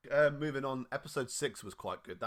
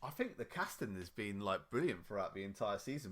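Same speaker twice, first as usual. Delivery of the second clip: ordinary delivery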